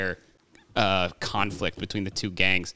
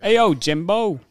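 loud, high Boston accent call; 10 semitones higher, 5 dB louder, slower than usual. Shown as a demonstration. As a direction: belt it out like a Boston guy. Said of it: "sounded like a Boston accent".

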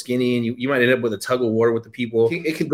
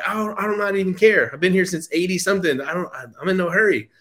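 voicing the old lady; 6 semitones higher than usual. Voicing the elderly seller.

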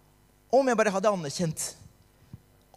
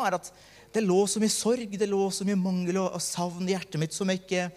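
usual speaking voice

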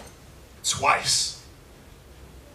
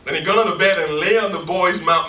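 booming scripture reading, every phrase pressed; preaching scripture aloud; 6 semitones higher, 9 dB louder than usual. Acted. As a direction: proclaim the verses to the congregation.

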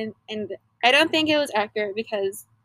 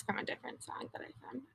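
whispering; 22 dB quieter, faster than usual. Real, not acted. Aside after lyric game.

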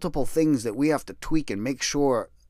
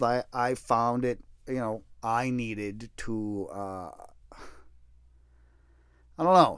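ordinary talk, nothing marked

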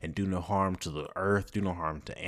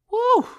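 loud high whoop of joy; joyful whoop; 13 dB louder than usual. Real, not acted. Host whooping with joy about her home. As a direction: let out a happy woo. Joyful outburst after gratitude.

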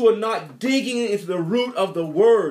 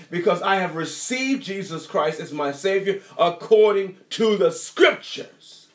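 ordinary delivery

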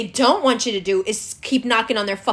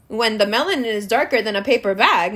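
normal talking voice

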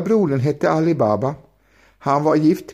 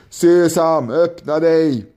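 a commanding call to the mountain, a bit louder; 4 semitones higher, 3 dB louder, slower than usual. A narrator's reading. Robber leader commanding the mountain to open, a firm call. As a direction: robber leader calling out the magic words. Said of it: "ropade han".

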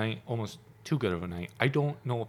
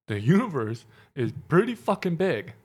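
emphatic disbelief; 7 semitones higher than usual. Retold or imitated. Repeats point insistently in retold argument.

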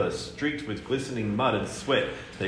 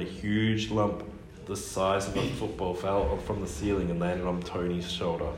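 usual speaking voice

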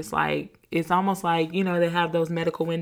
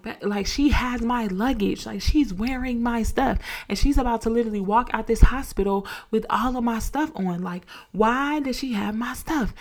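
upset protest; 4 semitones higher than usual. Retold or imitated. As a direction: refuse to calm down, pressing every word. You need to calm down.

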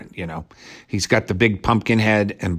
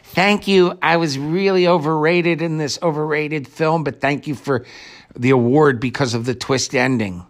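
high, gushing mock thank-you from a child star; a hammed-up little boy accepting an award; mock acceptance speech; 5 semitones higher than usual. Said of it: "the little skinny boy going up there going".

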